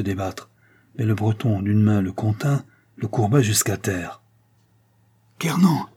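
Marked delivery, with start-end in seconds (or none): 5.4-5.9 high, urgent appeal; broken-voiced plea. A narrator's reading. Captive forced to the ground by Kernan, pleading in a broken voice. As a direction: cry out his name in panic. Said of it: "d'une voix entrecoupée".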